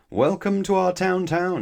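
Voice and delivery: high, loud ceremonial greeting; mayor's welcome. A narrator's reading. Mayor greeting a visitor to town, proclaiming his welcome. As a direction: proclaim a welcome on one sustained high note.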